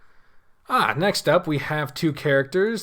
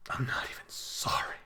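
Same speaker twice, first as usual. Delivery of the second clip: breathy whispered aside; 12 dB quieter than usual. Real, not acted. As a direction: whisper it like a secret. Cheeky aside after picking Chester.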